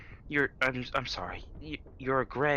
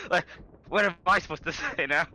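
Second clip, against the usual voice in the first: ordinary talk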